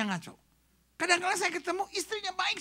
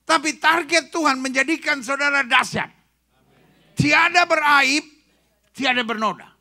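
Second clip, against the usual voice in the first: emphatic preaching; 9 dB louder than usual. Acted. Preacher declaring God's target.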